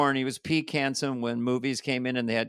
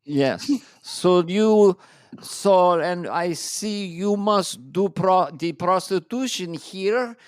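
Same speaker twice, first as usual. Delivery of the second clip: Borat impression; 3 semitones higher, slower than usual. Continuing Borat bit.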